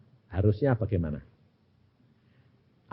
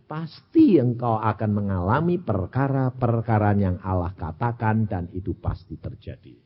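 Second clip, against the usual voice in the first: ordinary talk, nothing marked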